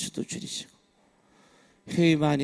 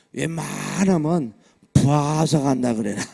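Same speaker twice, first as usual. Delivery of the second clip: louder, slow, stretched Chungcheong phrasing; Chungcheong drawl; 6 dB louder, slower than usual. Shown as a demonstration. Preacher teaching the evasive answer, slow Chungcheong drawl. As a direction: drag the vowels out, Chungcheong style. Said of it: "꼭 그렇게 얘기를 하라고".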